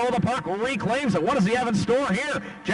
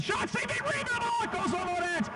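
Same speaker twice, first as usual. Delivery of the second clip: excited high call; 4 dB quieter than usual. Real, not acted. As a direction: voice shoots way up on the save. Save and rebound, high call.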